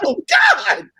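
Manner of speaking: high shouted panic; shocked scream. Retold or imitated. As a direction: yell it in horror. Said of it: "It's like".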